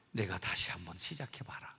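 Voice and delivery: soft whisper; quiet whispered offer; whispered encouragement. Acted. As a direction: hush it like a gentle promise. Jesus offers new start.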